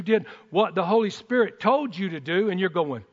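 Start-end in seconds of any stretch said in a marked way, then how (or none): none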